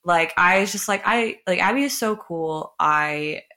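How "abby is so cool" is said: normal talking voice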